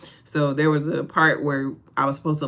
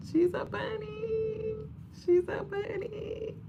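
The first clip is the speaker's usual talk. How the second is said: much higher, softer and slow; slow tiny squeak; 8 dB quieter, slower than usual. Acted. Proudly showing off her crocheted bunny, slow and high. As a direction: coo it slowly like baby talk.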